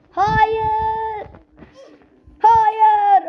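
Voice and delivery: despairing no. Real, not acted. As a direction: wail no as you fail. Character dying in game. (çaresiz, yükselen "hayır")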